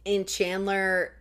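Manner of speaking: usual speaking voice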